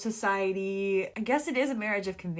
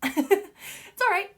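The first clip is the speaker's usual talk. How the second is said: slow, higher, giggling reassurance; laughing; 10 semitones higher, much slower than usual. Real, not acted. Reader laughing off her own drama. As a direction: laugh while easing it off.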